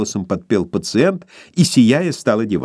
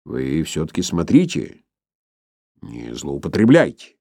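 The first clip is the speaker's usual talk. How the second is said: low, grave doctor's warning; stern warning, lower; 6 semitones lower than usual. A narrator's reading. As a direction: wag a finger and warn him gravely. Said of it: "предостерегающе и хмуро".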